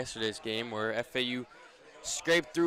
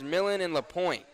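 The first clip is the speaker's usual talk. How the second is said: ordinary talk, nothing marked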